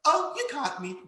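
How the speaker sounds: put-on a caught kid giving up cheerfully; mild child voice; playful kid. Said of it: "he was like".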